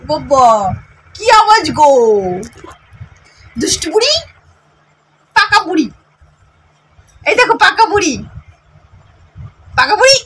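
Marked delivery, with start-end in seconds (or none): 1.2-10.2 high, loud delighted coo. Real, not acted. Cooing loudly at baby.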